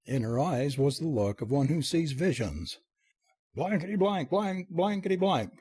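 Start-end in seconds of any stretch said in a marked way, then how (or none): 3.5-5.5 high-pitched torrent of censored oaths; mock roar. A narrator's reading. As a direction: let the pent-up curses burst out. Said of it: "roared Chester in part".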